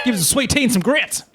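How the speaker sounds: high, hammed-up Southern voice; Southern accent. Host mock-orders sweet tea and grits, silly Southern accent. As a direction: play up a silly Southern accent through giggles. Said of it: "I always give it a Southern accent".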